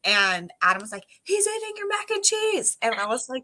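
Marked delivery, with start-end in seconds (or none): 1.2-2.8 high calling out across the house; yelling. Retold or imitated. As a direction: call up the stairs in alarm.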